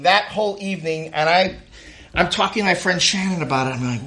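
normal talking voice

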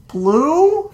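high, laughing disbelieving rise; just woke up and can't believe it; groggy surprise. Retold or imitated. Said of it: "I was like".

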